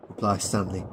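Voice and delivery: ordinary delivery, nothing marked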